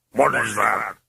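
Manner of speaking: guttural demon, suspicious; a wary, growling demon. A narrator's reading. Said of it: "The demon looked wary".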